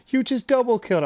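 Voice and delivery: high, loud amazed announcement; mock friend blurting out the news; excited. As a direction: tell him the news in shock. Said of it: "He goes".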